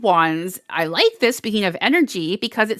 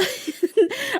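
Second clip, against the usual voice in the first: high giggle. Real, not acted. Laughs at to-do lists joke.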